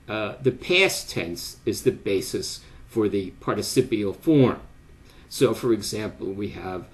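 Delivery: usual speaking voice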